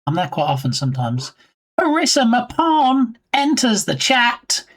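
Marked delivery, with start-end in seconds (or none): 1.8-4.6 announcer voice. Acted. Announcing a chat arrival.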